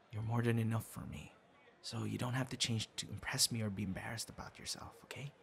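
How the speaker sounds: whispered, tender reassurance; soft reassuring whisper. Acted. Reassuring the scriptwriter privately in a tender whisper. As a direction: lean in and whisper it kindly.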